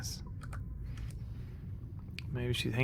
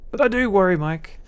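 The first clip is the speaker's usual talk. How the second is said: much higher hammed-up female voice, upset and pleading; upset wife Sarah; 4 semitones higher, 4 dB louder than usual. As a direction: play the crying wife in a high put-on voice. Voices Sarah, still worried.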